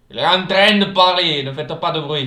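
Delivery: loud, high character voice; silly put-on voice. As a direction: boom it out in a goofy voice. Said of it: "Pourquoi je prends cette voix?".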